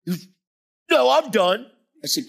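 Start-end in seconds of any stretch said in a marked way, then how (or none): 0.9-1.7 much higher, sharp and loud; huffy finish. Retold or imitated. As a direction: slam the door on it. Angry man finishes his rant.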